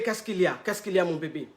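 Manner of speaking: gentle concern. Retold or imitated. Coach soothing upset client.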